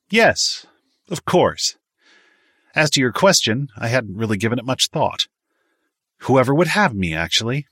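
put-on high, emphatic, snooty young man; haughty. A narrator's reading. Miles answers Clyde about joining the service, haughty. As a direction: answer as if the question is beneath you. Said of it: "gave him a haughty sniff and answered".

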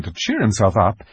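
plain reading voice

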